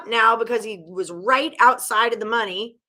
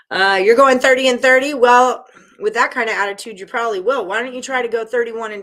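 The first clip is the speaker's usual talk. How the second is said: teasing; 2 semitones higher, 7 dB louder, faster than usual. Real, not acted. Teasing viewer's negative attitude.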